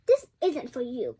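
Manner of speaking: loud, grabbing outburst; a sister's loud spiteful cry; cried out. A narrator's reading. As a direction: snap it as you snatch the invitation. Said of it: "cried Anastasia".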